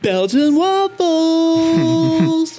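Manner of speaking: hammed-up longing for waffles; mock dreamy craving. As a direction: stretch out waffles like a dreamy glutton. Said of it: "You're like".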